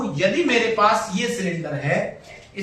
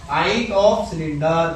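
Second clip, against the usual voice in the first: slow dictation; 4 semitones lower, much slower than usual. Shown as a demonstration. Teacher repeats for copying.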